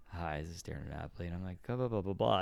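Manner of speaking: hushed, low and quick phone intro; low, calm phone voice. Retold or imitated. Telemarketer on some days introducing himself softly, low and calm. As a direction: keep it down, rattling through the introduction. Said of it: "talking real low and".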